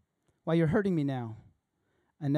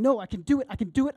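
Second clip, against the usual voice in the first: desperate insisting; 7 semitones higher, 6 dB louder, faster than usual. Acted. The struggling self insisting.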